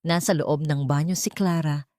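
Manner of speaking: ordinary delivery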